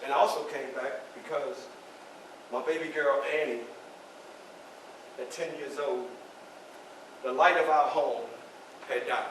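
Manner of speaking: low, soft, with long pauses; halting, subdued grief; grieving. Acted. Father telling of his baby girl's death, grieving quietly.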